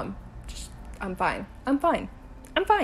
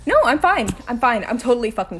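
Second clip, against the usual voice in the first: snapped denial; 8 dB louder than usual. Acted. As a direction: bark that you're fine. Insisting calm after near miss.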